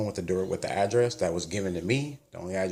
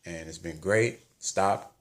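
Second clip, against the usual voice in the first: ordinary talk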